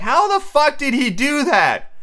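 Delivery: high, drawn-out mock disbelief; astonished. Viewer watches a painter finish, astonished. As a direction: exclaim in stretched, high-pitched amazement. Acted amazed viewer reaction.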